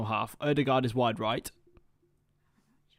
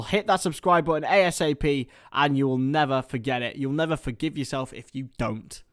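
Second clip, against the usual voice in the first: normal talking voice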